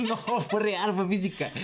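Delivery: high-pitched, laughing insistence. Real, not acted. Guest joking about his celebrity fight, laughing.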